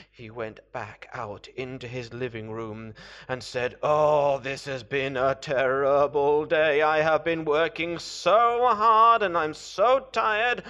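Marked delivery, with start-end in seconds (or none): 3.8-10.6 loud, self-pitying moan; weary lament. Acted. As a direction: complain loudly about an exhausting day. Worn-out character laments his day.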